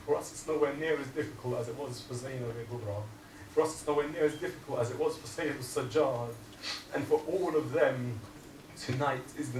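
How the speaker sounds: preacher building the repeated line; preaching build. Acted. Preacher to mourners, building the refrain with force.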